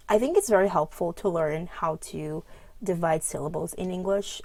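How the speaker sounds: ordinary delivery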